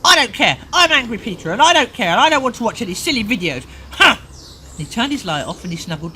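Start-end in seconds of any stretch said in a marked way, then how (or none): 0.0-4.2 put-on loud, high, stubborn child shouting; angry shout. Parent voicing Peter shouting alone in bed, angry shout. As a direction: shout it out in defiance.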